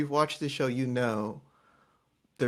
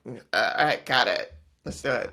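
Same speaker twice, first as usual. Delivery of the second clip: put-on drunk voice; 5 dB louder than usual. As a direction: play it loose like she's tipsy. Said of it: "That's her drunk voice".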